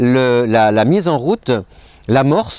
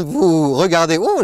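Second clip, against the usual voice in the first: voice cracking; 2 semitones higher than usual. Real, not acted. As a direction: voice breaking mid-phrase. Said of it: "j'ai la voix qui mue".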